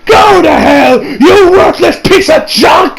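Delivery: furious high shout, stretched out; Vegeta. Retold or imitated. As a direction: hurl the insult in acted rage. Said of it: "with that Saiyan rage".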